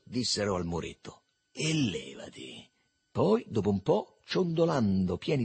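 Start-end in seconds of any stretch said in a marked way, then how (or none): 1.5-2.7 low, slow, scornful dismissal; sprezzante. A narrator's reading. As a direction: dismiss him with quiet contempt. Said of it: "con aria di disprezzo". (con disprezzo, basso e lento)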